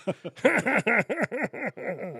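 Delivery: high wheezy laugh imitation; imitating a celebrity's laugh; Seth Rogen laugh. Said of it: "This is just a laugh impression".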